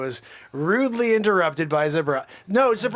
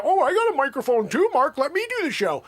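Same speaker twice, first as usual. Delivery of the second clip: high, louder whiny pleading voice; host mocking Zabrosk asking for the mic, higher whine; 8 semitones higher, 4 dB louder, faster than usual. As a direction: beg like a pushy sidekick. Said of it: "try to take over the".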